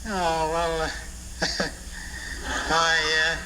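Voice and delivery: ordinary talk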